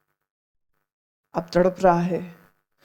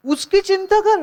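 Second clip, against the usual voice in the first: much higher, scolding exclamation; exasperated rebuke; 10 semitones higher than usual. Real, not acted. Preacher scolds the selfish wife, exasperated. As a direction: throw up your hands at her.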